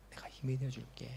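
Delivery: 힘이 barely voiced, softly whispered; "힘이" hushed, whispered low and soft; whispered promise. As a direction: whisper "내가 힘이 되어줄게" gently. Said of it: "주님께서 말씀하십니다".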